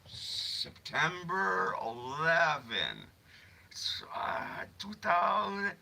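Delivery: slow, drawn-out mystic prophecy voice; spooky psychic. Pretend. Pretend psychic foretelling a date, slow and eerie. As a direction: intone it like a fake fortune teller.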